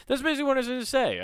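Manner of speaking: quick high throwaway; rushed wrap-up. Real, not acted. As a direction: hurry past the clumsy ending. Quick self-deprecating wrap-up.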